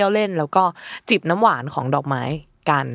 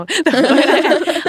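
loud high laughter; 10 semitones higher, 6 dB louder than usual. Real, not acted. Cracking up over cat comparison.

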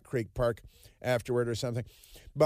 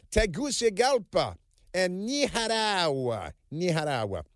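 "Nicaragua" with a breathy h for the g, slow and higher; overdone Spanish "Tegucigalpa" and "Nicaragua"; mock Spanish pronunciation; 4 semitones higher than usual. Mocking the media's fussy Spanish pronunciation. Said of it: "they trip all over themselves to say".

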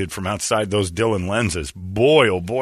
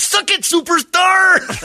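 high, slow, hammed-up yell of a taunt; a buddy gloating at an NBA star, hammed-up high yell; mock taunting yell; 5 dB louder, much slower than usual. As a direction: crow it out like you just won the spot.

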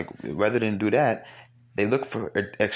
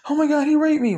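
high-pitched, louder mock cry of alarm; mock scream; 5 dB louder than usual. Host imitates a woman falsely accusing a man, high mock scream.

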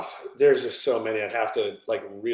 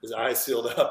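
ordinary talk, nothing marked

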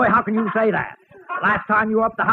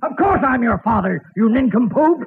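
normal talking voice

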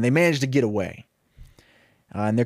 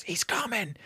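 barely voiced, much softer warning; mouthed; 7 semitones higher, 10 dB quieter than usual. Woody mouthing a warning to the sheriff, near silent. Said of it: "and he mouths".

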